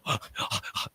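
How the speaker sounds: quick unvoiced imitated panting, soft; panting like a dog. On the plane floor, too sick to breathe, imitating panting. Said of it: "like a dog panting".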